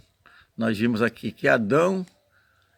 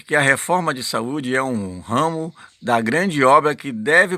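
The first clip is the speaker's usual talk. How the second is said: preaching read-aloud; 4 semitones higher, 5 dB louder than usual. Acted. Reads the prophet's text aloud.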